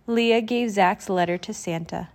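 ordinary delivery

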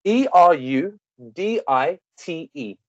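letters spelled slowly one by one; spelling it out. Shown as a demonstration. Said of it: "Can you spell it?".

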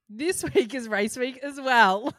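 loud and slow through a laugh. Real, not acted. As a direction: laugh out the news. Excited laughing announcement.

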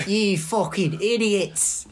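slow, high mock British accent, drawn out; hammed-up British accent insult. Nate on the phone scolding the group, high mock British accent. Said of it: "he's got a British accent in this".

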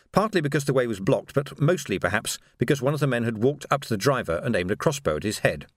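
ordinary delivery, nothing marked

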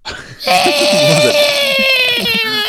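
high, loud orc grunt. Pretend. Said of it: "Some orc grunts".

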